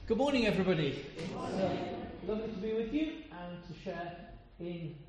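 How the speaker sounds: normal talking voice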